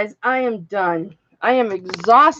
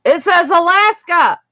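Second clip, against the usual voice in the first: excited; 6 semitones higher, 5 dB louder than usual. Real, not acted. Triumphant after finally decoding word.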